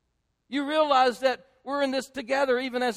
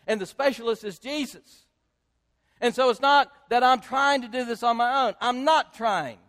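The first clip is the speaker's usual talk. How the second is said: ordinary talk, nothing marked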